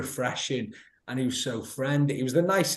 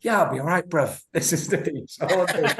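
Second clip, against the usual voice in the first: laughing cheeky reply; 6 semitones higher, 4 dB louder than usual. Retold or imitated. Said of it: "He goes".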